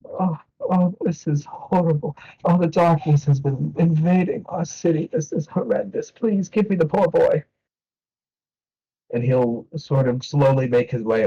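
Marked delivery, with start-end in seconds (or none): 0.0-7.4 put-on dismayed old priest. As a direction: lament the darkness over the city. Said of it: "priest continues".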